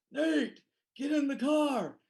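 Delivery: high, loud call of the name; older brother shouting at him from the van, high and loud. Retold or imitated. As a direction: call him over from the car, impatient. Older brother calls from car.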